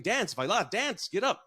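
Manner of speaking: high, loud mock call to get up and dance; mariachis calling out. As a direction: imitate the mariachis cheering them onto the floor. Mariachis urging the couple.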